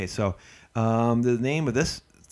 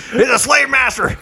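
much higher and much louder, slow shout through laughter; loud, slow laughing shout at his co-host; 13 dB louder than usual. Real, not acted. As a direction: yell it out while cracking up. Laughing call-out of co-host's accent.

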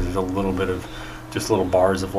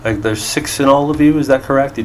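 lightly voiced, higher-pitched innkeeper; innkeeper halfling; 6 semitones higher, 3 dB louder than usual. Innkeeper answers guest about rooms, lightly voiced and high. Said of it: "[name], what is the inn capacity at?".

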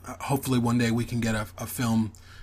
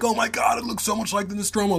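high-pitched imitation of excited fans; breathless-fan quote, pitched well up; retold freaking out; 8 semitones higher than usual. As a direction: gush it high, mimicking everybody freaking out. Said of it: "just everybody freaking out".

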